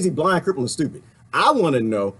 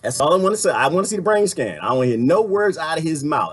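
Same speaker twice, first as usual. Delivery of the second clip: normal talking voice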